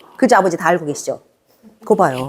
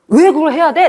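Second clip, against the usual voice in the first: surprised question; 7 semitones higher than usual. Retold or imitated. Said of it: "내가 물었죠".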